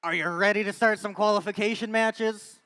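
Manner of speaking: hyped arena announcer voice; fighting game announcer. Acted. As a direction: pump up the crowd like a match host. Hypes teams in the arena.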